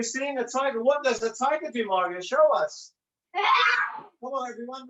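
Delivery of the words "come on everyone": excited call. Real, not acted. Coach urging the children at home, excited shout.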